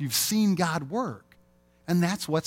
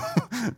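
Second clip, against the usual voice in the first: short laugh; 10 semitones higher than usual. Real, not acted. Laughs at own joke.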